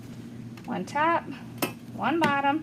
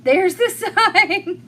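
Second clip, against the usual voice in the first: laughing, slow, louder correction; amused self-correction, slowed down; 6 semitones higher, 7 dB louder, slower than usual. Real, not acted. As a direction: fix the slip while laughing. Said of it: "Nope, that's the bow".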